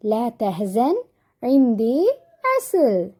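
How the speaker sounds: slow, drawn-out bee's reassurance; lightly voiced bee, stretching out each phrase; kind bee. Bee consoling the hungry chicks, slow and lightly voiced. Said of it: "എന്നിട്ട് എന്താണ് പറയുന്നത്".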